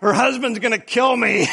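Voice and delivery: mock-panicked shout at oneself. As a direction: replay the horror of the misdirected text. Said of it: "and I go, I go".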